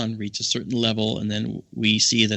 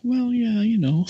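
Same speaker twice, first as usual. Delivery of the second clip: modest shrug voice; 11 semitones higher than usual. Retold or imitated. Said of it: "she's like".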